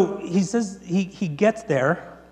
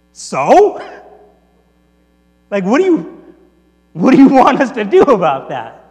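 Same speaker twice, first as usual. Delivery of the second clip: loud, higher-pitched scoffing retort; dismissive retort; 4 semitones higher, 13 dB louder than usual. Retold or imitated. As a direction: throw it back loudly, as if who cares. Other woman's dismissive retort.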